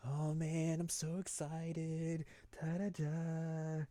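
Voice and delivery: high, soft, slow sing-song chatter; bubbly idol girl humming; girly excited voice. Retold or imitated. Rise arriving at Yu's house, high bubbly voice.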